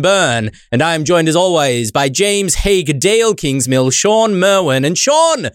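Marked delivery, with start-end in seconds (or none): none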